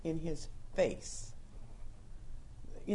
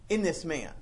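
usual speaking voice

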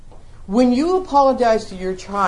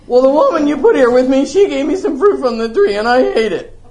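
louder, higher, quick excuse-making; defensive blame; 6 semitones higher, 7 dB louder than usual. Retold or imitated. Adam blurting his excuse to God, louder and quick. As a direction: point the finger fast, half laughing. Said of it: "The man does this".

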